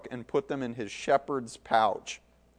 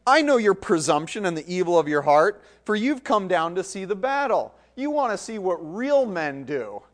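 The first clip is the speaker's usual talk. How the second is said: put-on louder, sneering older brother; contemptuous rebuke; 5 semitones higher, 3 dB louder than usual. Eliab belittles David before the men, sneering. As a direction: look down on him hard. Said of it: "you can hear his tone".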